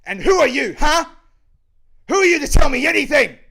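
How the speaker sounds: furious, high-pitched shout; full-force enraged yell; angry shouting. Acted. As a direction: scream it as a challenge. Angry character confronts his father.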